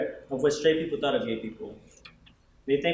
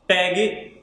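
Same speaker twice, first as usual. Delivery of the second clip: loud jeers in a raised voice; imitated yelling; 5 semitones higher, 5 dB louder than usual. Other kids hurling insults as he walks by, imitated yelling. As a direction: yell each insult like jeering schoolkids. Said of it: "the other kids yelling out".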